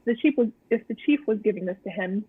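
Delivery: usual speaking voice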